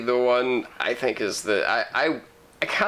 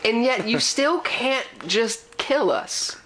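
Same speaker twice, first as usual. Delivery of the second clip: slow, rising dramatic plea; mock pleading; 6 semitones higher, slower than usual. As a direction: beg the aliens theatrically.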